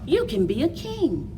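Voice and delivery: ordinary delivery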